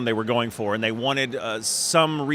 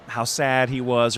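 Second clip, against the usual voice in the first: ordinary delivery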